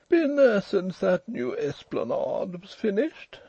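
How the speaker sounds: high, slow, overbearing drawl; stretched, high-pitched toff voice. A narrator's reading. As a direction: ask it with lordly slowness. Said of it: "drawling, overbearing fellow with an eyeglass".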